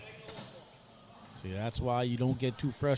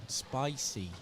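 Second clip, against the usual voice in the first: put-on New Orleans accent; 4 dB quieter than usual. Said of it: "saying these with an accent".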